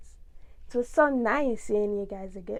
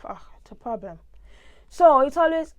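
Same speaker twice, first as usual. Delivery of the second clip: ordinary talk, nothing marked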